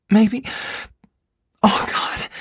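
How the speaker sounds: high gasping Maybe, then Oh God; lost in pleasure, breathless; breathless climax. Acted. Partner climaxing in bed.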